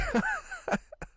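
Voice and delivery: high squealing laugh; real helpless laughter. Real, not acted. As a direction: giggle up high. Laughing at retold scene.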